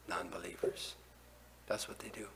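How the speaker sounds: usual speaking voice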